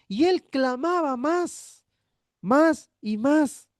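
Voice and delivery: slow building emphasis. Acted. Clamoring more and more.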